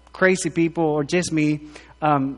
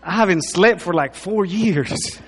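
normal talking voice

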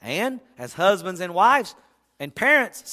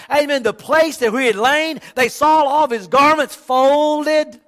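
loud, driving sermon voice; stirring preacher's climax; fiery preaching; 3 semitones higher, 4 dB louder than usual. Real, not acted. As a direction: preach it full force to the church. Preaching at full force.

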